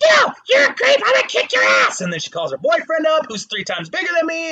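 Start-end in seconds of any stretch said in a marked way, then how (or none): 0.0-2.0 high, loud furious yell; angry shout. Retold or imitated. Angry woman at the bar yelling at him. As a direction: scream it like she's about to hit. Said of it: "It's like".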